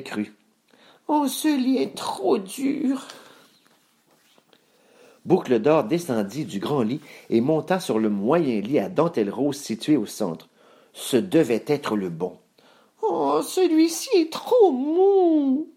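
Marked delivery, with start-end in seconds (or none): 1.1-3.1 put-on slow, very high girl voice; complaining little girl. A narrator's reading. Boucle d'Or trying the big bed, high drawn-out complaint. 13.0-15.7 put-on slow, very high girlish whine; complaining little girl. A narrator's reading. Boucle d'Or trying the middle bed, high drawn-out complaint.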